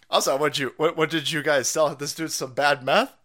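usual speaking voice

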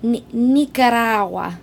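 "Nicaragua" slowly, with no hard G, ending in -awa; silent G. Shown as a demonstration. Speaker demonstrating "Nicaragua" for friends, G silent, slowly. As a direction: drop the G in "Nicaragua", drawn out. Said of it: "you can't pronounce the G".

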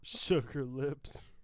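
slow, teasing, drawn out. Pretend. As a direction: stretch it out mockingly. Mocking the nickname.

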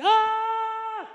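high, sustained "Ah!" scream; imitated scream. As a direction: mimic a crazed man's long high scream. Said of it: "He is screaming".

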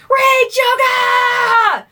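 high, drawn-out metal screech; metal scream. Pretend. Host introducing rage yoga, metal shout. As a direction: belt it like a metal frontman. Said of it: "my best metal impression".

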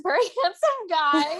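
high, giggly admission; laughing. Real, not acted. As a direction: let a laugh break through as you say it. Laughs while admitting he was handsome.

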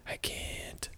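whispered, breaking into a laugh; faint whisper of giving up; hushed helpless I can't. Retold or imitated. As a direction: barely voice it, then laugh. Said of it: "And I'm all like".

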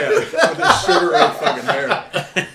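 high, loud laughing. Real, not acted. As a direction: laugh through the words. Keeps laughing.

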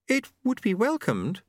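high, prim and polite; Lady Lejeune voice. A narrator's reading.

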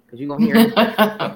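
giggling emphatic punchline; laughing. Real, not acted. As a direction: laugh it out, loud and amused. Laughing punchline about the gospel TV.

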